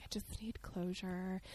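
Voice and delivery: soft whisper; slow, mock-hushed whisper; whispered retelling. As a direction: hush the quote. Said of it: "she's like".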